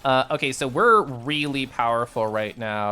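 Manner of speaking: usual speaking voice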